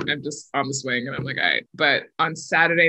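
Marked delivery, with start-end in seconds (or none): 1.4-1.7 very low, unimpressed aside; mock-casual shrug in the voice; blasé acceptance. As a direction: drop the pitch right down, as if routine. Acts out midweek indifference on swing.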